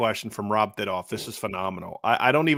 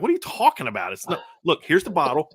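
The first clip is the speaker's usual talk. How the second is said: higher-pitched incredulous protest; retold bickering, pitched up; mock exasperation; 5 semitones higher than usual. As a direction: argue back in disbelief. Acting out argument with wife.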